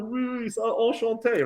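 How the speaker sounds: high-pitched, pinched French greeting; imitating dazed polite French. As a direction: squeak out French pleasantries while hurt. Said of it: "I'm like".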